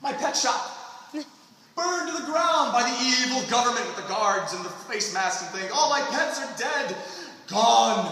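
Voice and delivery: a hammed-up sob story, pitched high and loud; mock tragic wail. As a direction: ham up the grief of a ruined hero. Mocking a tragic backstory.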